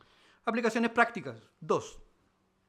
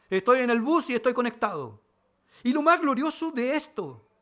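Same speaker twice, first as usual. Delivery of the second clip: normal talking voice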